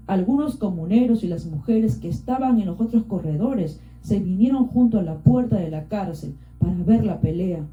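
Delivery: ordinary delivery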